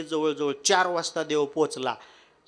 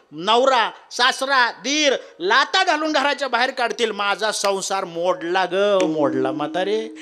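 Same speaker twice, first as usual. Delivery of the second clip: wailing daughter-in-law; 6 semitones higher, 9 dB louder than usual. Acted. God wails as a daughter-in-law.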